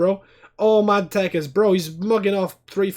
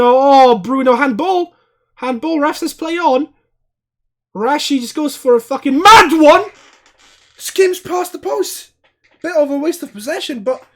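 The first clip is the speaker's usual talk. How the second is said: loud, high, excited shouting; calling the chance at full volume; excited yelling; 7 semitones higher, 9 dB louder than usual. Real, not acted. Handball claim then shot.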